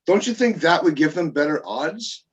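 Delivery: ordinary delivery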